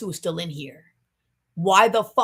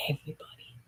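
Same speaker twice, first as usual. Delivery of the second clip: whisper; 13 dB quieter than usual. Acted. Dramatic reveal ends in hush.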